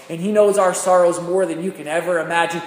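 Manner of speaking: loud, rising preacher's cry; fervent climax; preaching at full force. Acted. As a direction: hit it hard for the congregation. Preaching peak.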